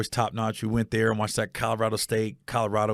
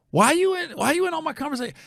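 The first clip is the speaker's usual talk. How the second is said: much higher, incredulous why-are-you-here; annoyed disbelief. Retold or imitated. Comedian to a chatty stranger at the bar, high and incredulous. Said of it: "I was looking at her like".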